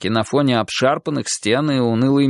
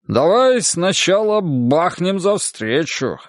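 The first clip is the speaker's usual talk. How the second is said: higher, slow hearty order; bossy host; 6 semitones higher, slower than usual. A narrator's reading. Host proposes a drink to the reunion, bossy and hearty. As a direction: take charge like a host, drawing it out. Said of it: "распорядился хозяин".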